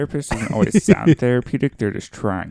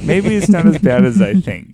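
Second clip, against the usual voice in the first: higher, louder mocking echo; mocking repeat; 6 semitones higher, 6 dB louder than usual. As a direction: parrot her words back teasingly. Mocking sister's words.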